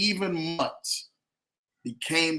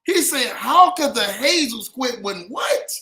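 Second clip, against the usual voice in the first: loud, higher, excited interruption; amazed outburst; 4 semitones higher, 7 dB louder than usual. Real, not acted. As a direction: demand a repeat in loud disbelief. Amazed listener demands a repeat.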